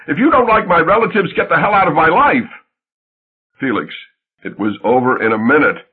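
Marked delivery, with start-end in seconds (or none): 0.1-2.5 angry yell, much higher. Retold or imitated. Wife yelling him out of her life, angry shout. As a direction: throw it at him in rage. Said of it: "Genevieve, you yelled".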